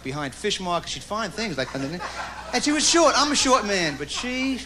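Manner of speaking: ordinary talk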